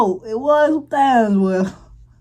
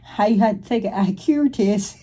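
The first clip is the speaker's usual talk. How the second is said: lightly mimicked southern accent; imitated southern accent; 3 semitones lower, 3 dB quieter than usual. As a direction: echo her southern accent, a little lower. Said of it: "That accent, boy, that accent".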